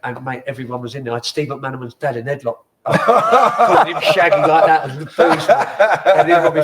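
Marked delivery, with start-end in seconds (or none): 2.9-6.6 laughing loudly through the words; roaring with laughter. Real, not acted. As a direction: blurt it out between laughs. Laughing while retelling wild night.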